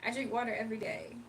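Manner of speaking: normal talking voice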